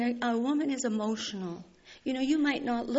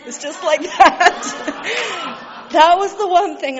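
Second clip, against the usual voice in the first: loud, slow, joyful laughter through the words; laughing with relief; 6 semitones higher, 14 dB louder, slower than usual. Real, not acted. Relief after hearing God's voice.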